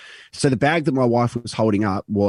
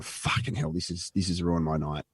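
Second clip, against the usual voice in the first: whispered under breath, softer; muttered curse; 3 semitones lower, 8 dB quieter than usual. Retold or imitated. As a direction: grumble it to yourself quietly. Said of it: "I'm going".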